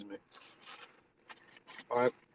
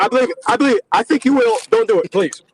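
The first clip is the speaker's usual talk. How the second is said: louder, higher begging; loud high pleading; 6 semitones higher, 6 dB louder than usual. Acted. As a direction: beg him to stop. Begging him not to shave.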